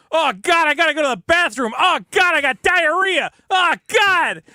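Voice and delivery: high, loud hammed-up panic about needing the bathroom; faked desperate panic. Faking diarrhea to skip line.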